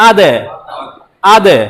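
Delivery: আদে with দ bounced off; echoed ad. Shown as a demonstration. Teacher demonstrating the echo of dal. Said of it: "তাহলে এটাই ছিল কলকলা".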